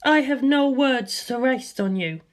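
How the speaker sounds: clipped cold dismissal; curt. Acted. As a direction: dismiss him curtly. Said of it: "Lisa Lisa said curtly".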